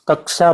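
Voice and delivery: "कक्षा" said kak-sha with the sha sound; correct pronunciation. Shown as a demonstration. Teacher repeats for the class: "कक्षा" as kak-sha. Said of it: "pronunciation होना चाहिए शा की तरह".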